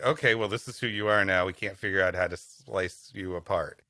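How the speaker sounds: normal talking voice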